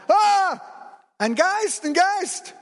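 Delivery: loud, high-pitched shriek of alarm; terrified screaming. Acted. Disciples in the boat see a ghost, screaming in terror. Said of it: "hörst du die anderen schreien".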